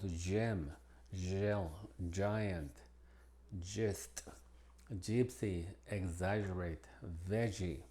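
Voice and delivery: G said as English J in gem, giant, veggie; tongue on the roof of the mouth for the J sound; English soft G as J. Shown as a demonstration. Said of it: "se pronuncia como la J en inglés". (G como la J inglesa)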